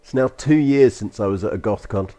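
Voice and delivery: ordinary talk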